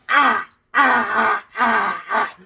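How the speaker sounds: imitated cries of pain; hammed-up groan from the ground; mock moaning lady. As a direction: fallen lady on ice groaning.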